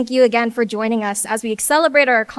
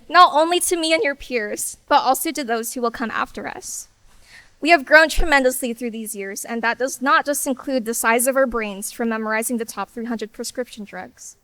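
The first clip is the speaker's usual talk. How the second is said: ordinary delivery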